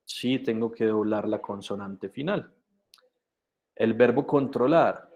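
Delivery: ordinary talk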